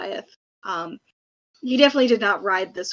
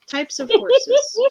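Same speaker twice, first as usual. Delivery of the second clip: amused drawl; 10 semitones higher, 3 dB louder, much slower than usual. Acted. Joking, drawn-out word play.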